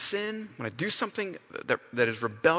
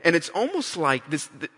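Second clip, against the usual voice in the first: ordinary talk, nothing marked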